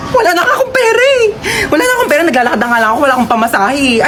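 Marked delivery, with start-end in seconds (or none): 0.1-2.2 much higher, fast, crying whine; robbed man whines to the holdupper, high; whiny crying. Acted. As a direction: wail it like a broke victim. Robbery victim whining.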